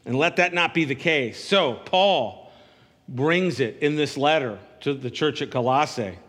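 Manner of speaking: ordinary delivery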